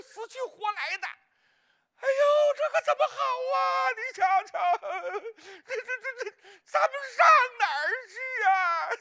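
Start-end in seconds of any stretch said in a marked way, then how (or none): 2.0-8.9 high, loud mock wailing, half crying; hammed-up wailing. Sister imitating the lovers weeping at the bridge, hammed-up high wailing. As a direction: wail it out in a high, loud, theatrical sob. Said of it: "哭的哟".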